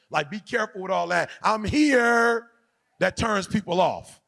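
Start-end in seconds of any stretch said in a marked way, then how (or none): 1.4-2.5 mock showy entrance. Mocks a showy guest.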